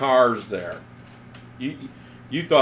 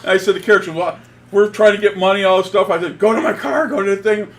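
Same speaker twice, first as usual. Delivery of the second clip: higher, louder, laughing through the words; can barely hold the laugh in; laughing while retelling; 8 semitones higher, 9 dB louder than usual. Real, not acted. Retelling sending his wife for cash, laughing loudly.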